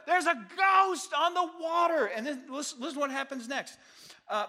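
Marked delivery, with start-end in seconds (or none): 0.1-2.1 high, loud panicked shout; hammed-up scream of alarm; mock terrified screaming. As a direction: yell it like a frightened disciple. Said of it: "it was like screaming".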